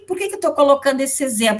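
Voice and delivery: ordinary talk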